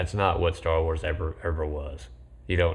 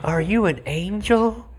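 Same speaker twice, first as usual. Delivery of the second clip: much higher, slow, loud swooning; cheesy romantic line; 13 semitones higher, 6 dB louder than usual. Retold or imitated. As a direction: overplay it like a lovestruck teen. Quoting the cringey romance scene.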